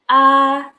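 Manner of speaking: A held high and level; high flat A; tone one. Shown as a demonstration. Teacher quizzing tone one on A. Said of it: "Nada satu kayak gimana?".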